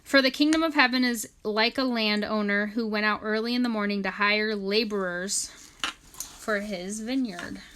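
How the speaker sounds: scripture reading. Acted. Reads scripture aloud.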